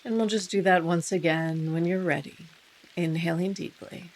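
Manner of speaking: unhurried meditation guide with long pauses; guided meditation. Real, not acted. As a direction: ease listeners into stillness, softening as phrases end.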